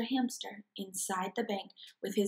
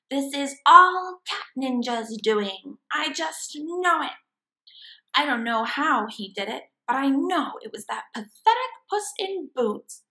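louder, higher angry rant; furious villain; 4 semitones higher, 6 dB louder than usual. Acted. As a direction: rage at the cat. Villain raging.

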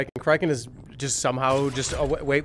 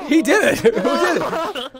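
laughing; 12 semitones higher, 5 dB louder, much slower than usual. Real, not acted. Laughing at a sudden accusation.

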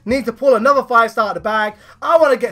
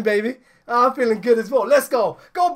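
ordinary talk, nothing marked

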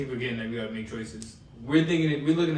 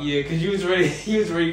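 laughing; 5 semitones higher, 10 dB louder than usual. Real, not acted. Laughing teasing a friend.

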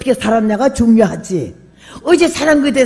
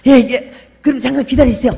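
loud polite reply; 3 semitones higher, 6 dB louder than usual. Retold or imitated. Wife's loud answer to guest.

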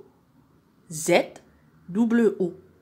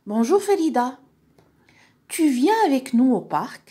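raised, high-pitched friendly invitation; Mustapha on phone; 6 semitones higher, 5 dB louder than usual. Acted. Boy inviting a friend to the park, high character voice. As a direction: voice a child caller, higher and louder.

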